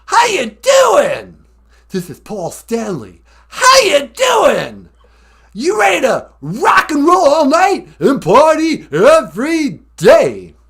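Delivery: very high, loud stage scream, slow; rock star scream. Shown as a demonstration. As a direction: scream to the stadium. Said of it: "a rock star scream".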